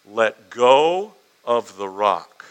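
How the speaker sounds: slow, loud, steady call; calmly calling down. Retold or imitated. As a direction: call it down slowly, stretching each word. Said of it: "he just calmly called down".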